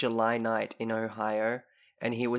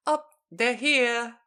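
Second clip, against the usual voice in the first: put-on creepy kid voice; 5 dB louder than usual. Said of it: "they were just like".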